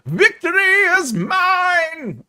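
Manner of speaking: high, slow, hammed-up "Victory is mine"; triumphant villain. Streamer gloating over a win, hammy villain voice.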